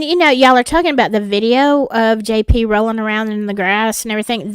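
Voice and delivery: normal talking voice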